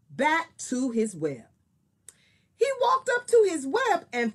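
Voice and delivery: lively storybook reading; story narration. Acted. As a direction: read it like a picture book to kids. Reads a picture book aloud.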